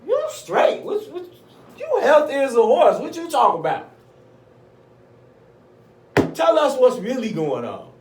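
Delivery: high, loud mock disbelief; incredulous hospital staffer. Acting staff's incredulous questioning.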